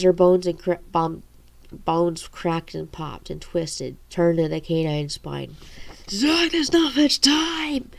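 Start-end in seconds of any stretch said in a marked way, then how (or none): 6.1-7.9 slow, high, breathless gasp; out of breath. A narrator's reading. Haley gasping to Zack as she changes, out of breath. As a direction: force the words out with no air left. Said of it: "Haley said out of breath".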